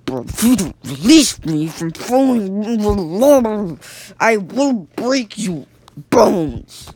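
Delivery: slow, loud and muffled, through a squashed mouth; muffled threat. Acted. Jonathan threatens the gang through a muffled mouth, slow and loud. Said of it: "in his muffled mouth".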